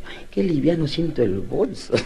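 put-on slow, soft little old lady puzzled by her bag. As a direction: voice a muttering old woman. Old woman feels her purse light.

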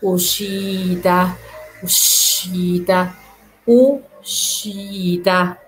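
teacher models اوشيتا slowly. Shown as a demonstration. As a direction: stretch اوشيتا with a clear sh. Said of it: "فهنا عندنا اس تشي".

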